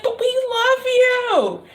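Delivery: slow, very high mock whimper; imitated tearful mother; pleading mom.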